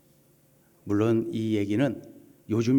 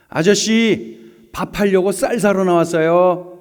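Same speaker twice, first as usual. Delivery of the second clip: higher, louder, bright comeback with a smile; woman driver's smiling, louder comeback; cheerful retort; 7 semitones higher, 8 dB louder than usual. Retold or imitated. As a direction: answer the rude man sweetly but loud. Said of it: "웃으면서 대했답니다".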